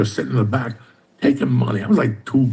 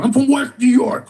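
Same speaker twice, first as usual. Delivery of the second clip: high, louder, thrilled to find a fellow Cuban; excited reply; 13 semitones higher, 3 dB louder than usual. Retold or imitated. A Cuban man learns the other guy is from West New York, excited high. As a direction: blurt it out high with delight.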